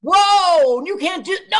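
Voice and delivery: high, hammed-up shout of protest; mock outraged yell. Viewer protesting at the screen, mock outraged yell. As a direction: cry out in exaggerated horror.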